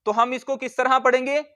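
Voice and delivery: usual speaking voice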